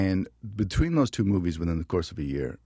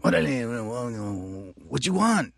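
tough gang member; 5 semitones higher, much slower than usual. Retold or imitated. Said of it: "and I'd just go".

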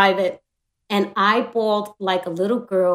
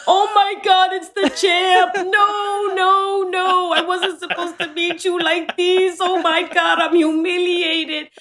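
starstruck gushing; 8 semitones higher, slower than usual. Retold or imitated. Starstruck fan gushing at the champ. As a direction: gush in awe of the champ. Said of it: "and I said".

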